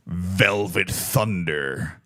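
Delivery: low, slow movie-trailer delivery; deep dramatic voice. Pretend. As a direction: drop the voice for a secret code name. Brooklyn Nine-Nine code name gag.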